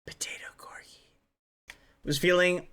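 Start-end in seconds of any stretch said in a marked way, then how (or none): 0.1-1.0 breathy whispered aside; whispering. Real, not acted. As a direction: whisper it under your breath.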